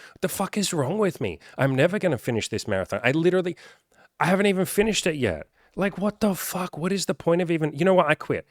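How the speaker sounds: high, whiny mock meltdown; mock despair. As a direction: overdramatic quitter giving up. Runner despairs after one step.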